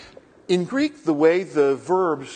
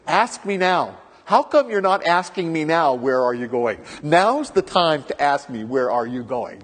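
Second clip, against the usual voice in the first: Jesus urging; 3 semitones higher, 6 dB louder than usual. Retold or imitated. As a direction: play Jesus nudging the disciples to ask. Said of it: "he goes".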